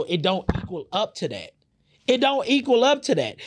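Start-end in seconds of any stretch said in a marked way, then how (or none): 2.1-3.4 emphatic outburst. Real, not acted. To the audience about the [name] diss, repeating it with a loud, high outburst. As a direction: repeat it much higher and louder, fired up. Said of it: "repeats line for emphasis".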